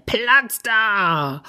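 pushy fox. A narrator's reading. Fox barging into the mitten, brusque. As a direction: shove your way in like a fox. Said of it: "Da stürmte der Fuchs herbei".

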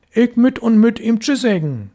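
put-on a small boy insisting to himself; determined little boy. A narrator's reading. Boy resolves to reach father.

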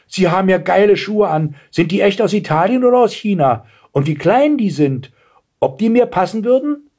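put-on high-pitched girl, full of curiosity; impressed girl. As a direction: gush like an impressed youngster. Girl admires the shoes. (staunendes Mädchen, hohe Stimme)

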